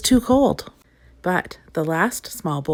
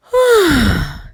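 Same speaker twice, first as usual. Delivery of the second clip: loud, high drawn-out yawn falling away; big loud yawn; 8 semitones higher, 8 dB louder than usual. A narrator's reading. Mister Lazy arriving sleepy, big loud yawn. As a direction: yawn like you can barely stay awake. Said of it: "He yawned".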